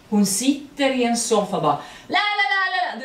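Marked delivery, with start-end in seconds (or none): 2.1-3.0 higher and louder mock stage yell; imitating an actress shouting every line. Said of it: "hon bara skriker".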